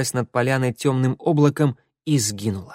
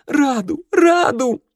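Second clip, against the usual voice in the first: desperate cry; 6 dB louder, slower than usual. Acted. Girl held back, calling friend.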